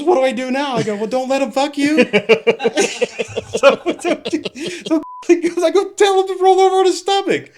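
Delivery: very high, loud, helpless laughter in the words; cracking up. Real, not acted. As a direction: barely get the story out for laughing. Cracking up retelling the exchange.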